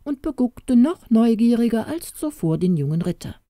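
ordinary delivery, nothing marked

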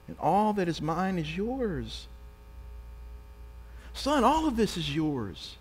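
gentle pleading father. Retold or imitated. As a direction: plead tenderly with a wayward son. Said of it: "The father responds".

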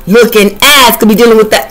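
normal talking voice

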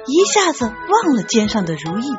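ordinary delivery, nothing marked

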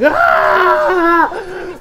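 high shouted cry of pain; anguished scream. Retold or imitated. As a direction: let out a loud, high anguished yell. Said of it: "Kirito is just like".

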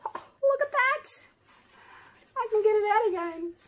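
high excited squeal; surprised. Real, not acted. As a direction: squeal at the sudden mess. Surprised at the leaking mold.

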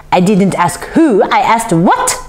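mildly shrill, irate interruption; affronted little man's cry; cried shrilly. A narrator's reading. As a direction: cut in with fussy theatrical outrage. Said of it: "the man cried shrilly".

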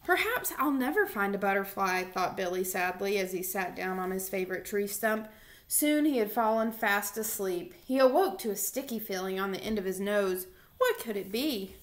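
lively storybook reading voice; reading to children. Acted. Teacher reading a picture book aloud to her boys, animated. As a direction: read it out like story time for little kids.

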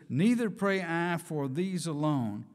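ordinary delivery

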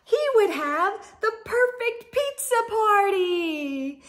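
ordinary delivery, nothing marked

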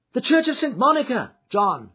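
high, loud call to the driver; urgent cry. A narrator's reading. Lady to her coachman John, crying out. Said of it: "she cried".

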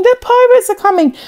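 high, fast, urgent shout of warning; frantic alarm call. Acted. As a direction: shout it to wake the whole village. Boy raises the pirate alarm.

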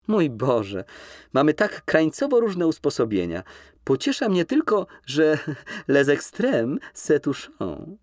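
laughing coquette. Acted. Nina laughs off their differences, high and playful.

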